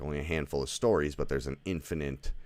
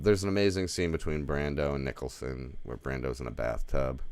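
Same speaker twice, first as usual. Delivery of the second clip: normal talking voice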